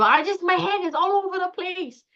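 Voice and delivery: high, frazzled outburst; acted overwhelm. Caller who can't name the feeling, acted overwhelm. Voices overwhelmed person, then thinking.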